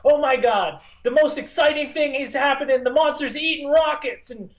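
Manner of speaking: hammed-up high-pitched amazement; mock panicked girl. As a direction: imitate a girl freaking out on camera. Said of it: "she'd be like".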